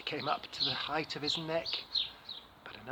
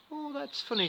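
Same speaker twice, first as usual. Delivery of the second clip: much higher, musing voice; puzzled Turtle; 13 semitones higher, 4 dB louder than usual. Acted. Turtle doubts the bird story, puzzled and high. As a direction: wonder aloud, doubting gently.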